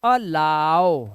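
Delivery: अलाउ drawn out slowly, stress on लाउ; slow demo. Shown as a demonstration. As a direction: stretch अलाउ out for the class. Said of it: "क्या पढ़ते हैं?". (अलाउ को धीरे खींचकर, लाउ पर ज़ोर)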